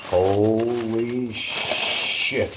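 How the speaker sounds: very slow stunned holy shit; retold inner shock. Thinking about the fan, slow stunned surprise. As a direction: let it drag out in disbelief. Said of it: "I thought to myself".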